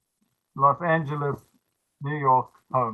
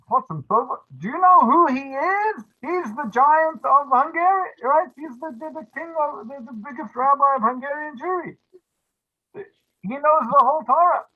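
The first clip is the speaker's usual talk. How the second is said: high, amazed voice; awed disbelief; 10 semitones higher, 4 dB louder than usual. Retold or imitated. Rabbi awed that the Chasam Sofer is proposed, high disbelief. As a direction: gasp it out in awe, pitched well up.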